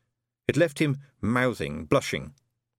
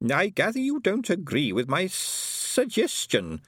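high, slow, musing character voice; thoughtful; 9 semitones higher, slower than usual. A narrator's reading. Herr Schugen probing the bank's disagreement, thoughtfully. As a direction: draw it out high, pondering. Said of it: "said Herr Schugen thoughtfully".

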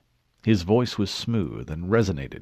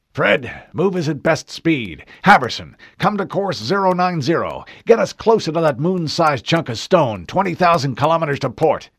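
loud, higher command voice; barked orders; 8 semitones higher, 7 dB louder than usual. Acted. Admiral ordering his helmsmen into position, loud. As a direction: snap out orders to the helm.

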